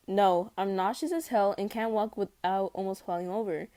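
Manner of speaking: plain reading voice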